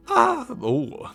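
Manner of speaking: sudden high cracked "ah"; voice-cracking "ah, ooh". Real, not acted. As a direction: let the voice break upward into a squeak. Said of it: "that voice crack was nuts".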